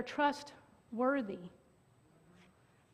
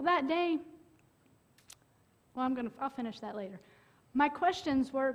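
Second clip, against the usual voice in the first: ordinary talk, nothing marked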